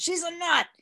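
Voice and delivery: high-pitched mock outburst; mock exclamation. Speaker mimicking Bill's complaint about Missus Jones, mock exclamation.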